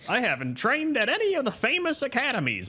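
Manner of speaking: high-pitched little gnome pirate; Gunner Pops. Acted. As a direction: squeak it out like a boastful gnome.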